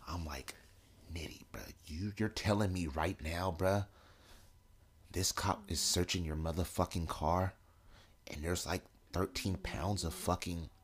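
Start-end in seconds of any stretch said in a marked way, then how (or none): 1.1-10.7 low, very soft undertone; hushed disbelief. Retold or imitated. Teller to friend while car is searched, hushed disbelief. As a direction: keep it down so the cop can't hear.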